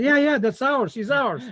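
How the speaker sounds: put-on high, loud child's cry of recognition; imitating a thrilled child, high and loud; excited kid claiming. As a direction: shout it like a proud little boy. Kid claims him again, retold.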